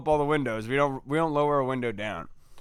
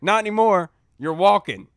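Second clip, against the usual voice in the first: louder, firm cop order; stern cop; 5 semitones higher, 8 dB louder than usual. Retold or imitated. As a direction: lay down the law. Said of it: "He's like".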